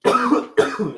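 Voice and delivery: harsh hacking cough; loud rough coughing; coughing fit. Real, not acted. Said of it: "Sorry I'm coughing so much".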